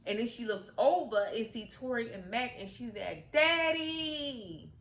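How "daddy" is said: high, stretched-out happy call; excited child's cry. Retold or imitated. Daughter sees Mac and calls out, high excited cry. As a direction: call out high and hold the last vowel.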